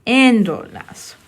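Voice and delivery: "Endolas" slowly, with a heavy first syllable; stress on EN. Shown as a demonstration. Teacher showing where the accent goes, stress on first syllable of "Endolas". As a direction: lean hard and long on the "En" of "Endolas". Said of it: "you'll have to add an accent here".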